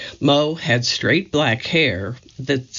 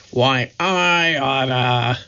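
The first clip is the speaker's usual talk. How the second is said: slow, lightly growled threat; a hint of a gangster growling; Moe's growl; slower than usual. A narrator's reading. Moe threatening Curly before slapping him, slow light growl. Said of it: "Moe growled".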